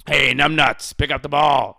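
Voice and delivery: mild coach impression; lighter coach impression. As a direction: toss it off in the coach's voice. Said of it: "He'd call you numbnuts".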